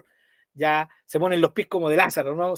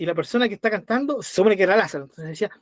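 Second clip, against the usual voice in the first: usual speaking voice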